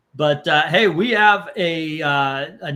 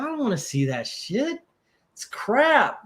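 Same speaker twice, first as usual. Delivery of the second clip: higher, mock snooty scoff; snobby dismissal; 8 semitones higher than usual. Movie snob refuses his wife's pick, mock snooty scoff.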